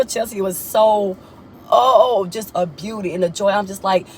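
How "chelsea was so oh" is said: ordinary delivery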